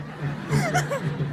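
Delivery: very high clicking mouth sound; high mock clack noises; imitating clicks. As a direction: mimic the pens clicking.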